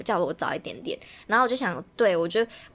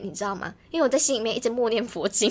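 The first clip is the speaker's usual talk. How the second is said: normal talking voice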